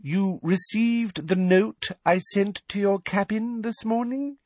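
high, slow, patronising; loud slow voice. A narrator's reading. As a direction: talk down as to a slow foreigner. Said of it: "in the same loud, slow voice".

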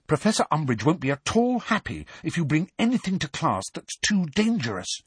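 high, pressing warning; urgent plea. A narrator's reading. Hermione warning Hagrid about Umbridge, high and urgent. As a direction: press the danger home. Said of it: "said Hermione urgently".

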